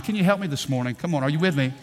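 ordinary delivery

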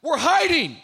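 loud, high answering yell; shouting back. Retold or imitated. Adam foolishly shouting his hiding spot to God. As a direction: yell it back without thinking. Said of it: "He shouts back".

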